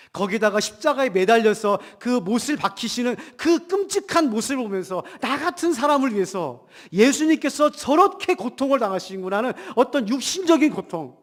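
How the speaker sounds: ordinary talk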